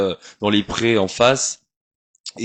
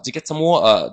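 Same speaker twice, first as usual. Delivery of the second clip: English twang; 5 semitones higher, 4 dB louder than usual. Shown as a demonstration. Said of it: "le twang anglais".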